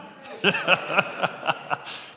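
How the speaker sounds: short burst of chuckling; amused laugh. Real, not acted. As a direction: chuckle at your own daring. Laughs at own accent plan.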